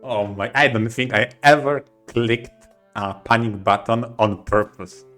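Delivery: ordinary talk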